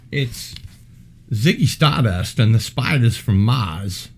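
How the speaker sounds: mock British announcement, R's dropped; showy British-accented title reveal; put-on British accent.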